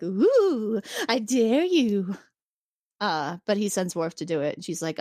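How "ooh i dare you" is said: slow, teasing mock challenge; taunting Ardra. Ardra taunting Picard from his chair, slow teasing dare. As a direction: draw out a coy dare.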